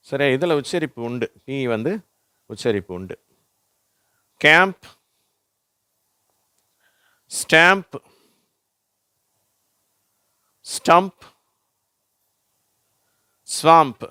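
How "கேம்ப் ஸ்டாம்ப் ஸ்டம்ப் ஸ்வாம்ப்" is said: loud, high-pitched, one word at a time; model word reading. Shown as a demonstration. Teacher reading words for pupils to repeat, loud model reading. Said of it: "நான் வாசிக்கிறேன், நீங்களும் திருப்பி சொல்லுங்க".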